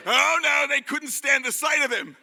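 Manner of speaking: loud, high, hammed-up shout; big theatrical "oh, no"; pantomime exclamation. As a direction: top the crowd's booing. Pantomime build-up with audience.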